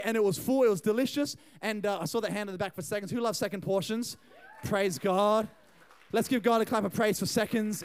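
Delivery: ordinary delivery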